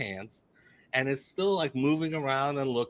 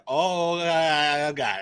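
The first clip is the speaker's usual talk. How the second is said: imitated horror; 5 semitones higher, 7 dB louder, much slower than usual. Friend's horror in retold story.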